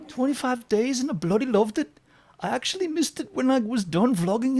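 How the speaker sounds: very high-pitched attempt at an English accent; mock English accent. Host reading Gina's comment in her accent, high mock English voice. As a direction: put on a squeaky, bad English accent. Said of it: "I'll read it in her accent. ... she's English".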